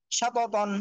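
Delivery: ordinary delivery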